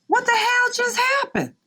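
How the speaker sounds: high, baffled what-the-hell; stunned disbelief. Retold or imitated. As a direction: throw up your hands in shock at a stolen idea. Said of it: "And you're like".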